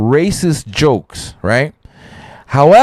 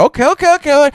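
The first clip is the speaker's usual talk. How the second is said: much higher eager okays; learning a new custom, pitched high; impressed; 13 semitones higher, faster than usual. Retold or imitated. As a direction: react with sudden amazement. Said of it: "like, like".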